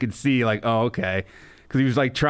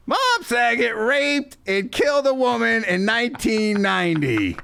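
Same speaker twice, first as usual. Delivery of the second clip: imitating Gilbert; 10 semitones higher than usual. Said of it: "he was like".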